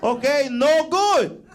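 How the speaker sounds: hammed-up Spanish-accented mom. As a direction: lecture like an offended abuela. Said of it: "she's like".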